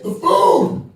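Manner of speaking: high, loud cry of delight; excited exclamation. Real, not acted. Comic hyping holiday food to the crowd, loud and high. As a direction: belt it like it's the best part.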